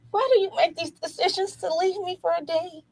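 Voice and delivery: high, plaintive mock complaint; mock maternal lament. Mother complaining about son leaving, high mock lament.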